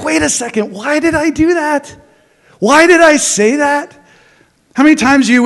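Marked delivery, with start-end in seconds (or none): 0.0-4.0 much higher, startled voice of regret; catching yourself too late, higher; regretful self. Retold or imitated. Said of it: "I've been like".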